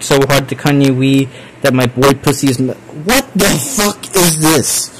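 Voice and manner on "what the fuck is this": very slow, drawn-out disgusted question; disgusted disbelief. Real, not acted. Streamer reading a gross confession, slow disgusted disbelief. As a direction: recoil slowly at what you read.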